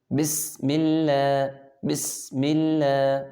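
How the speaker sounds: slow "بسم" with a long hissing, whistled s; whistling seen. Shown as a demonstration. Teacher shows pupils the letter seen, whistling s in "بسم". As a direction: hold the whistle on the س of "بسم اللہ". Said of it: "تو اس کی ادائیگی میں سیٹی کی آواز نکالیں گے".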